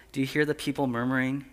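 soft, hushed scene-setting voice; quiet, unhurried imagination exercise; guided meditation. Acted. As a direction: guide them gently into the picture.

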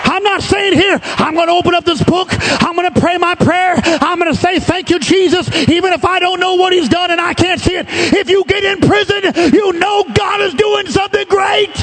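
rising from high preaching to a ringing shout; building preacher's climax. Acted. Preacher rousing the church out of the dry place, building to a shout.

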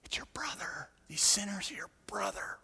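hushed, pointed whisper. Pretend. Jesus quietly pressing the Pharisees about their brother, whispered. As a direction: lean in and whisper the rebuke.

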